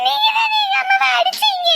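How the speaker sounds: high, loud sobbing shout; crying accusation. Acted. Child accusing grandmother of hitting mother, crying shout. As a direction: hurl the blame through tears.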